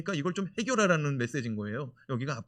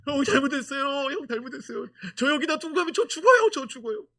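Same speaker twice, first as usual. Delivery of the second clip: desperate pleading; 11 semitones higher, 9 dB louder than usual. Retold or imitated. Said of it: "요셉이 말합니다".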